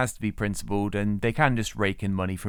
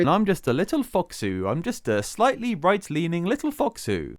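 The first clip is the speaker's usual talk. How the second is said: mocking cute foxu voice; 6 semitones higher than usual. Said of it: "That seems very targeted to me".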